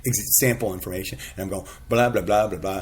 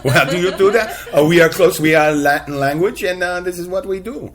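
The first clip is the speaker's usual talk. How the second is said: hammed-up French accent, higher and louder; mock French accent; 7 semitones higher, 7 dB louder than usual. Host joking with guest in a mock French accent. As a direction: play a Frenchman explaining how they speak. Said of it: "throw on a French accent".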